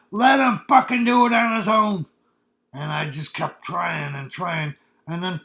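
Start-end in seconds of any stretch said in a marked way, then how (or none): 0.1-2.1 loud, higher angry shout from the cab; father's yell. Retold or imitated. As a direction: yell it harshly at the helpful fireman. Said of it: "my old man yells out the window".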